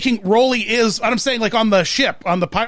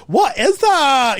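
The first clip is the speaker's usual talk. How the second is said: high, slow mock Scottish toddler accent; kids mimicking Scottish; 6 semitones higher, much slower than usual. Kids copying a YouTube toddler, high Scottish accent. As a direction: stretch it out in sassy toddler Scottish. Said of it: "my kids all go around being like".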